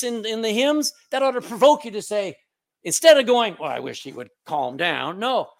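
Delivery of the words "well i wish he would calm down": disapproving grumble. Acted. Same disapproving churchgoer voiced.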